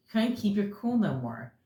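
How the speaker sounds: ordinary delivery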